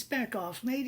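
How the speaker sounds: high-pitched mock-Australian voice; Australian accent, pitched well up; Australian businessman. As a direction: imitate an Aussie telling someone off. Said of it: "Australian businessman".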